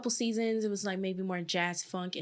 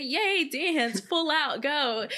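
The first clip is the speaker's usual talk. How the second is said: higher, cheering mock enthusiasm; eager rookie; 5 semitones higher, 4 dB louder, much slower than usual. Dancer mocking her eager younger self in preseason, excited cheer. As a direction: play your over-keen younger self, pitched up. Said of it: "just twenty-two-year-old [name] with the afro".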